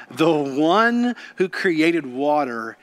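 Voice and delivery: normal talking voice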